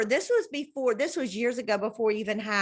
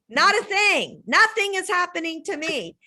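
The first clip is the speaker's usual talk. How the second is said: mock complaint; 8 semitones higher, 4 dB louder than usual. Mimicking friend's complaint.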